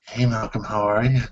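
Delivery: lightly put-on female voice, soft; a reluctant, understated go at a woman's voice. Shown as a demonstration. Greeting Malcolm on request, soft half-hearted female voice. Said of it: "let's see your female voice".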